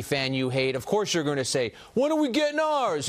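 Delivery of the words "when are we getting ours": mock Brooklyn fan accent, pitched much higher; Jersey or Brooklyn accent. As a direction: whine it like a Brooklyn fan imitating a Yankee supporter. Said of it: "Was that a Jersey accent or a Brooklyn accent".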